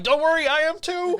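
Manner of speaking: to Twitter fans crying at an ad, a high mock-weepy squeak; mock weepy reply. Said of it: "And I'm like".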